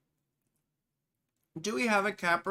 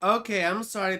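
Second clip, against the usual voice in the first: ordinary talk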